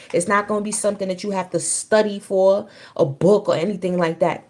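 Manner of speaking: usual speaking voice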